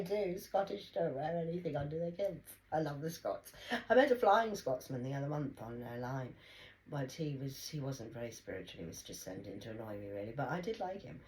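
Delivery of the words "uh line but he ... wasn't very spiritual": ordinary talk, nothing marked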